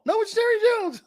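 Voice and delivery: very high, squeaky contradiction; ventriloquist's puppet. Acted. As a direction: argue back in a puppet squeak. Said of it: "Is that you talking or Jerry?".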